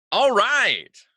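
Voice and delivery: loud, high, bursting all right; emphatic all right. Retold or imitated. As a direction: throw out the all right like a cheer. Bracing himself after bad weeks.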